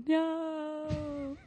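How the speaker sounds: high, sharp shocked no; horrified no. Retold or imitated. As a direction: recoil at the mirror. Looking in mirror, shocked.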